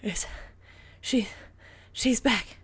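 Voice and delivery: panting between words; breathless, gasping out the news; out of breath. A narrator's reading. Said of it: "Ray panted".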